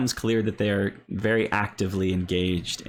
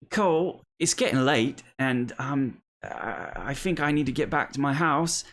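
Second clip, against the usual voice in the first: put-on high, hesitant little girl's voice; a kid reluctantly bowing out of the game; young child; 7 semitones higher than usual. Girl tells Cole she must go home, high and hesitant. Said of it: "you hear another voice".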